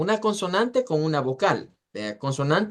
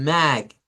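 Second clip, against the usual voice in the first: "mag" hit with force, alone; stress on MAG; 4 dB louder than usual. Shown as a demonstration. Teacher shows where voice force moves, stressed "mag". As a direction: punch the syllable "mag". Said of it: "la fuerza de voz va aquí".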